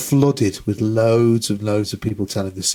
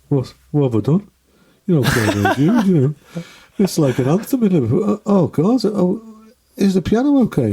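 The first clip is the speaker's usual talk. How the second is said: anxious inner worry, pitch climbing; fretting to himself mid-song; 7 semitones higher than usual. Retold or imitated. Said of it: "it was going through his head".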